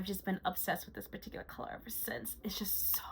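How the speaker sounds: soft unvoiced whisper. Real, not acted. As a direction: breathe it like a secret. Whispered aside about favorite color.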